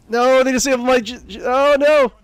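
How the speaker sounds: loud, high and frantic; panicked yelling. Real, not acted. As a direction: yell in real alarm.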